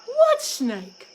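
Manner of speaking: high, loud startled question; startled husband crow; surprised. A narrator's reading. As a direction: jump at the news.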